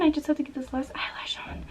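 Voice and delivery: hushed whisper to herself. Real, not acted. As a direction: whisper it while concentrating. Gluing eyelash, under her breath.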